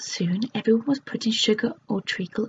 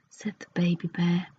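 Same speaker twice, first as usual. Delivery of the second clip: ordinary delivery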